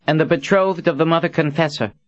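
high, formal herald announcement; officious. A narrator's reading. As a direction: proclaim it like a stiff court herald. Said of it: "Verdine said in an officious tone".

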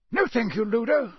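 testy official's polite no; impatient. A narrator's reading. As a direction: refuse with a bite of impatience. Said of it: "a bite of impatience in his voice".

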